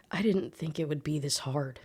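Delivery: soft, low-key confession; hushed, understated admission; close murmur. A narrator's reading. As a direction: say it softly, as if leaning in close. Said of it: "close enough to whisper".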